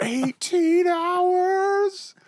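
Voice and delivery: very high, loud and drawn out; squeaky voice. Pretend. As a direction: stretch it out high and slow. Held squeaky meme line.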